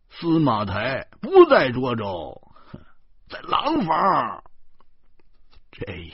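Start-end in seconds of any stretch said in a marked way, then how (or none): 0.1-4.4 slow, raised, emphatic announcement; cocksure correction. A narrator's reading. Driver corrects himself before everyone, loud and cocksure. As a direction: proclaim it with drawn-out certainty. Driver blusters out another wrong place.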